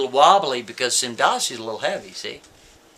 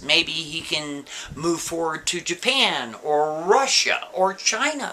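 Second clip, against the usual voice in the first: usual speaking voice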